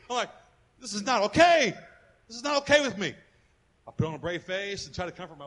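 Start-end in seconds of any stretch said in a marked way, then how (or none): 0.8-3.1 raised, higher pleading protest; upset father arguing with God; retold angry protest. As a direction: push back hard at God. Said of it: "I was screaming at God".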